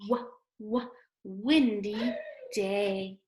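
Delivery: "Wah, wah" then "windy" with the W leaned on; stressed W sound. Shown as a demonstration. Teacher pointing out the W sound to kids, leaning on "windy". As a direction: hit the W in "windy". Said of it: "Today is a windy day".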